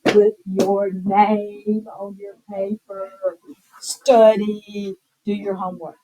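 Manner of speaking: slow, level-pitched orders; teacher's command. Shown as a demonstration. Teacher giving the class examples of imperatives, commanding. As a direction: issue each instruction slowly and deliberately. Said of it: "teachers will use an imperative".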